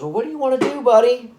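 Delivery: cheery dad to son. Retold or imitated. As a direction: ask the kid like a playful father. Father cheerfully asks his little son.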